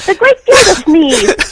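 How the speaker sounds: high, loud, slow cartoon announcer cry; hammed-up cartoon voice calling out a title; cartoon announcer impression. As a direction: boom the name out like a cartoon MC. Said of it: "on a Bugs Bunny cartoon".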